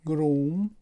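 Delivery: گروم again, oo long, rising; English groom repeated. Shown as a demonstration. Teacher repeating groom for students to copy.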